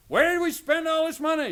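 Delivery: loud, high mock yell at spouse; hammed-up couple fight over money. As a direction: shout it like a frustrated spouse. Couple yelling about money.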